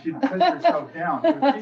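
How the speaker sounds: a fond laugh in the voice. Real, not acted. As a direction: tease her through a laugh. Teasing wife fondly.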